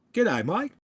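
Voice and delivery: high bright Aussie greeting to Mike; amateur Aussie accent; Australian accent attempt. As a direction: greet him the Aussie way, playfully. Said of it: "Do I sound like I'm from there?".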